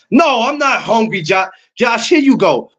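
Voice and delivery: high-pitched exasperated comeback; playful protest. Real, not acted. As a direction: protest the teasing, pitch jumping up. Protesting a friend's hungry tease.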